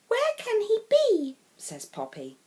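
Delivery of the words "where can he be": high, louder worried question; worried child. Acted. Poppy asking anxiously where the lost piglet can be. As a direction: wonder anxiously.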